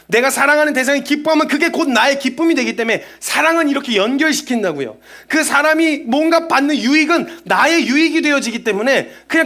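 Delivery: fervent preaching. Acted.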